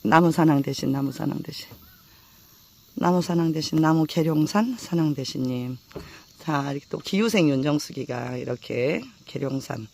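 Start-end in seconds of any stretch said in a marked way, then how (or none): 0.0-5.8 repeated chanted invocation of the mountain god, measured and devout; prayer invocation. Real, not acted. Hiker invokes the Gyeryongsan mountain god, devout chant. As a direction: call on the mountain spirit like a prayer.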